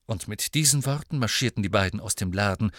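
plain reading voice

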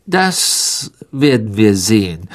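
understated malice; a touch of spite, said with relish; mildly venomous; spiteful. A narrator's reading. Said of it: "sagte Mister Malfoy gehässig".

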